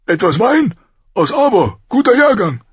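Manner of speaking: high, loud, hearty offer; brusque king. A narrator's reading. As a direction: boom it out as a blustering king.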